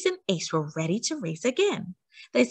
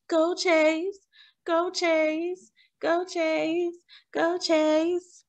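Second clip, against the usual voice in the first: higher chanted cheer; crowd cheering; 8 semitones higher, much slower than usual. A narrator's reading. Crowd cheering Chase around the track, higher excited chant. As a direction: cheer him on from the stands.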